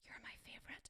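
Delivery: put-on soft secret whisper to her kids; mother whispering to her child, unvoiced. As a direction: breathe it like a secret. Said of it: "I go".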